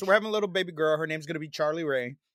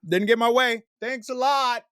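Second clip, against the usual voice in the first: raised, loud sarcastic thanks; mock sulky complaint; 5 semitones higher, 4 dB louder, slower than usual. Dad who wanted a boy, mock sulky complaint. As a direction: playfully gripe in a higher, exaggerated voice.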